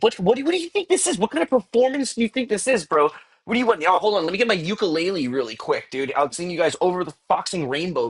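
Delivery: high-pitched, fast, half-joking outrage at chat; playfully indignant, how dare you ask; mock exasperation. Real, not acted. Mock outrage at chat's request.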